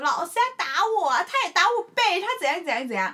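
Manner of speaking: put-on high, loud whiny kid tattling to the teacher; tattling child. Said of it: "他们就说". (小孩向老师告状，声音尖而响)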